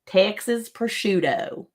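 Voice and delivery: slow, stretched-out Texas drawl; mock Texan, drawing out each word; Texas accent. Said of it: "That's how you say it".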